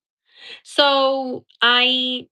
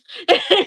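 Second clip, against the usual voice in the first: short bright burst of laughter; quick high laugh; 9 semitones higher, 6 dB louder than usual. Real, not acted. Laughing as she tells how she fooled Johnny. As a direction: laugh at the joke landing.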